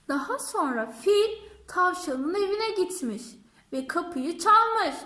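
ordinary delivery, nothing marked

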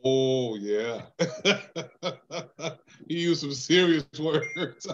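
talking through real laughter; amused answer breaking into laughs; laughing. Real, not acted. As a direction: say it while cracking up. Laughs at question about curse words.

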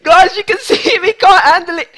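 laughing through the taunt. Real, not acted. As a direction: giggle while gloating. Laughing while opponent struggles.

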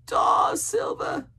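slow, loud, high hoarse cry; alarmed castaway. A narrator's reading. Castaway recognising the dreaded name, alarmed. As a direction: cry the name out, drawn long.

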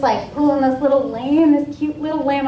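usual speaking voice

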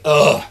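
loud, heavy mock groan; tired groan. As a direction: collapse into an exaggerated "ugh". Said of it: "we get tired".

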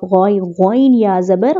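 ordinary delivery

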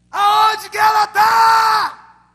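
high, slow, drawn-out shout; desperate cry to God. Retold or imitated. Friend praying on the mountain for a wife, desperate shout. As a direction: bellow it up to heaven, begging.

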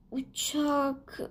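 usual speaking voice

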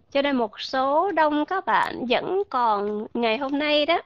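normal talking voice

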